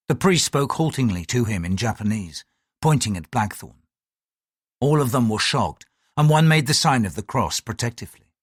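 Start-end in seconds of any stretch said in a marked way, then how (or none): none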